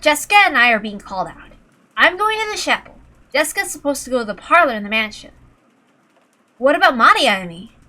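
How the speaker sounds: ordinary delivery, nothing marked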